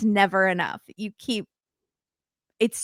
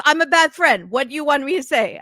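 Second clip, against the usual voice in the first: mock protest, higher and louder; defensive retort; 6 semitones higher, 4 dB louder than usual. Friend answering a hurt accusation, mock defensive. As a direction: throw it back as a defensive friend.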